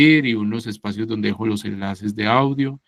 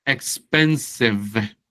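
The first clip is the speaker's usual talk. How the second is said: expensive said very slowly; teacher modelling expensive slowly; slow model; much slower than usual. Shown as a demonstration. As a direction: stretch out expensive. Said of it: "sería expensive".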